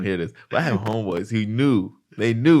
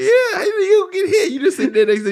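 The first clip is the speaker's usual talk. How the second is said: high, loud words squeezed out through real laughter; cracking up mid-sentence; laughing; 7 dB louder than usual. Real, not acted. Cracking up while telling a friend about the sauna, high and loud laughing talk.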